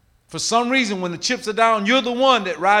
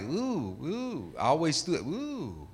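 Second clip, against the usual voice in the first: cringing ooh; 12 dB quieter, slower than usual. Retold or imitated. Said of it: "I'm like".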